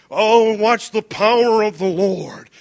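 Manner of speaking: slow, loud hammed-up proclamation; mocking Moses. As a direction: parody the grand speech. Mocking Moses' showy speech.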